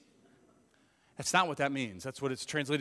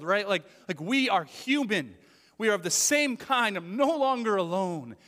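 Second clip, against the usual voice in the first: 1 joyful Adam; 6 semitones higher, 8 dB louder than usual. Retold or imitated. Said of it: "So he's saying".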